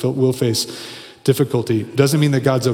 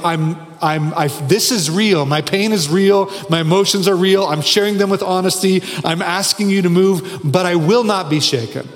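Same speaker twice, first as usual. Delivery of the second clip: higher, insistent declaration of resolve; standing firm; 6 semitones higher than usual. Acted. Preacher voicing David's honest plea to God, resolute. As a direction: plant each statement firmly, as if holding ground. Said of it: "like, grabbing, holding, standing".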